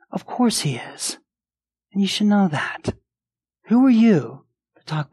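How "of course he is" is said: breathy whisper of certainty. Shown as a demonstration. Preacher to the congregation, whispering the reassurance. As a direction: whisper it like a secret truth.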